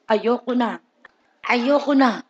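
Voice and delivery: soft, low sigh of giving up; half-hearted quitting. Retold or imitated. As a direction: mumble it like a half-hearted promise. Weak resolve voiced.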